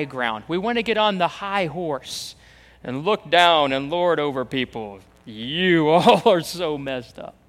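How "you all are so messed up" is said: slow, laughing put-down; mocking laugh. Preacher mocking self-righteous churchgoers, laughing scorn.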